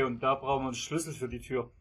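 usual speaking voice

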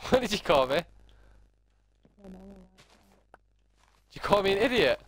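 playful fake offence at a friend; mock outrage. Real, not acted. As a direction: pretend to be offended. Teammate just called him idiot.

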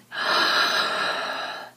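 long audible meditative inhale; big, demonstrative inhale; deep breath in. Shown as a demonstration. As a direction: breathe in deeply for listeners to follow. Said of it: "together we will breathe in".

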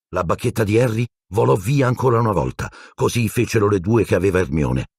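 ordinary delivery, nothing marked

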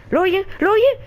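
high, rising urgent question; alarmed asking. Real, not acted. Player asking viewers if they hear it, alarmed.

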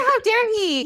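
high-pitched, giggly affront; mock indignation. Teller reacting to the prince's rudeness, mock indignation. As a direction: squeak it out as playful offense.

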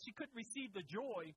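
ordinary talk, nothing marked

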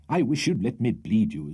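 usual speaking voice

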